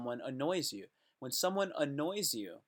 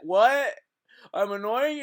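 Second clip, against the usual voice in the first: loud, high, rising disbelief; mock indignant surprise; 8 semitones higher, 10 dB louder than usual. Annoyer answering the complaint, mock indignant surprise. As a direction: act shocked at being called out. Imagined offended reply.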